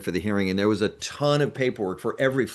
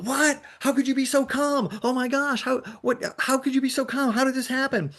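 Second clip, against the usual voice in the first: much higher, frantic disbelief; alarmed disbelief; 12 semitones higher than usual. Retold or imitated. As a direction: squeal it like a panicked friend. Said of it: "he starts freaking out".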